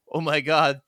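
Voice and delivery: normal talking voice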